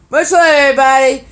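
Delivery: cheery loud sign-off. Real, not acted. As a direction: sign off with a burst of energy. Energetic sign-off.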